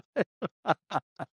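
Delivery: helpless high laughter; a real, hysterical laugh pitched high. Real, not acted. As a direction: break up completely at the joke. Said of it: "[name] is laughing hysterically".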